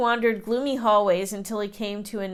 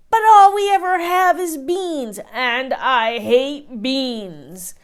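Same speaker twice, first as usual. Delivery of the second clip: whiny complaint; 4 semitones higher, 6 dB louder, slower than usual. A narrator's reading. Giant complains about beans.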